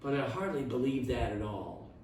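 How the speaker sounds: detective voicing his doubt in a hush; said very soft. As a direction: drop almost to a murmur. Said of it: "Holmes said very soft".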